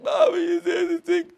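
put-on high, loud little kid calling out; child's voice. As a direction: call to mommy like an eager child.

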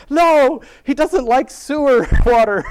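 put-on indignant little girl. Voicing her daughter objecting to flushing the fish, high and indignant. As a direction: squeal it like an outraged little girl.

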